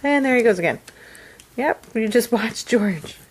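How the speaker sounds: ordinary talk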